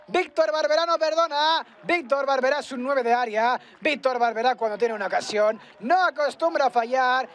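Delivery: high, loud post-goal praise; excited commentary. Real, not acted. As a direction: still buzzing after a goal. Right after the goal.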